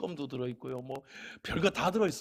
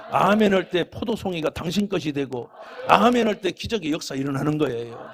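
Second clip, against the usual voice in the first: fiery preacher building to a blessing; preaching at full force; 9 dB louder than usual. Acted. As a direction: thunder it from the pulpit. Litany of amen promises.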